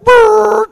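high loud brrr of brakes; braking noise. Retold or imitated. Imitating his truck braking hard for turkeys, loud. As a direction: make the sound of slamming on the brakes. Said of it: "And I, like".

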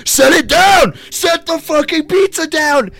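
high, loud shouted order; mock group yell. Friends yelling at Nathan to set the pizza down, shouted. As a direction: bark it at him to drop it. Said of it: "And we're like".

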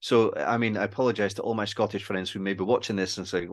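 ordinary talk, nothing marked

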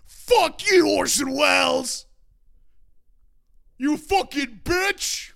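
high, drawn-out acted shout; hammed-up dad yelling at the TV; dad's furious outburst. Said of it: "he stood in front of the T V with me and went".

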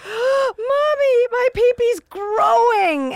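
put-on high, loud, slow panicked kid shout; child's yell. Son yelling to mom from the restroom stall, high panicked shout. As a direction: yell it across a public restroom like a startled child. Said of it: "and he yells out".

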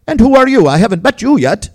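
put-on a vivacious old man's hearty welcome in a thick Latin accent. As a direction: slap his back and boom it in a thick Latin accent. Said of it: "saying in a thick sac- accent".

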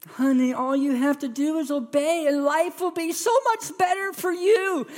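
put-on high, sing-song coaxing of a child; sing-song parent. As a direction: lift it high like a sweet-talking parent. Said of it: "You ever done that with your kids? It's just like".

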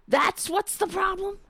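high cracking incredulous voice; raspy losing voice. Real, not acted. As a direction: squeak out the disbelief as the voice gives. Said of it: "Why am I sounding like Whoopi?".

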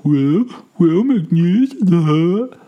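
slow, high, jeering mock voice; mocking imitation. Guy on the street mocking the comic, high slow jeer. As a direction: drag it out to ridicule. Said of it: "mocking me".

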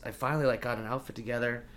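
ordinary talk

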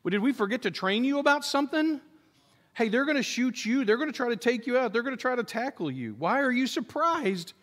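a hint of a baffled superior explaining the obvious; imitated incredulous commander. Commander answers a surprised soldier, in mildly mocking incredulity. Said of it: "the commander would be like".

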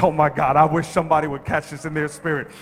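loud, high preaching with long drawn-out phrases; shouting sermon climax; full-force preaching. Acted. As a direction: preach it out to the whole room.

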